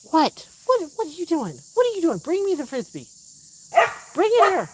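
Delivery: high, excited calls to the dog; high pet voice. Real, not acted. Owner asks the dog for the Frisbee, high excited pet voice.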